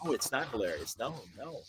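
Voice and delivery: quietly mortified correction; hushed, dry protest; deadpan correction. Retold or imitated. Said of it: "it's like".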